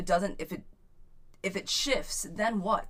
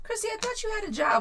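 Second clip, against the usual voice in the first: surprised; 4 dB louder, faster than usual. Real, not acted. Reacting to a viewer's comment.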